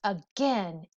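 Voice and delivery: usual speaking voice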